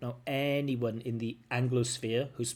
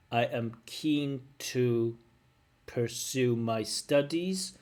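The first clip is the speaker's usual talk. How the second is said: very slow word-by-word plod; mechanical, unsure; much slower than usual. Shown as a demonstration. Teacher mimicking the candidate's answer, slow, mechanical and unsure. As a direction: separate each word as if unsure of it. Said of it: "It sounds unsure".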